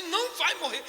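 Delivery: quick, firm "não vai morrer" said with a short laugh; firm insistence. Retold or imitated. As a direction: refuse to accept it. Insisting daughter won't die.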